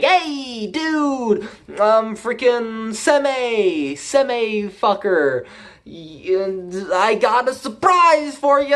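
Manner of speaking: high, slow sneering villain voice; hammed-up high villain greeting; mock villain taunt. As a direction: tease them like a smug villain.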